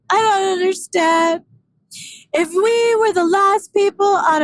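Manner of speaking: high, loud mock whine, dragged out slowly; whiny pleading friend. Mocking friend begging why she won't date him, high whine. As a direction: beg like a rejected puppy. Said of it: "what I hear is this voice".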